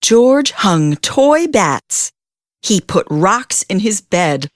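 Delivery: ordinary delivery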